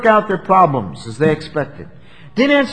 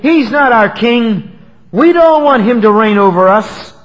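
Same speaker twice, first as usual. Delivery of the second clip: loud, raised voice of rejection; defiant crowd; 6 semitones higher, 8 dB louder than usual. Retold or imitated. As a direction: cry out as an indignant mob, acted. Acted crowd rejecting their king.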